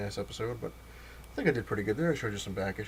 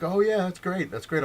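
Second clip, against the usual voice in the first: inattentive interviewer's enthusiasm; 9 semitones higher, 6 dB louder than usual. Retold or imitated. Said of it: "not paying attention".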